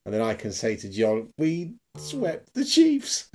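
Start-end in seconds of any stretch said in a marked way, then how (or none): 1.4-3.3 mock boast. Imagined boast to John.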